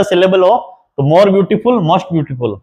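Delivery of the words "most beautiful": usual speaking voice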